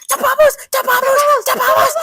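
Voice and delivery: repeated high-pitched shrieks, very loud; freaking-out cartoon fish. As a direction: imitate a fish losing it over bubbles. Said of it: "he starts freaking out".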